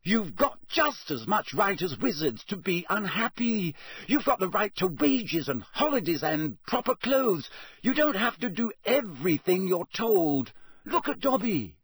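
high, passionate appeal; rallying the elves, fired up; crying out. A narrator's reading. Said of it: "Hermione cried".

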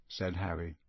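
usual speaking voice

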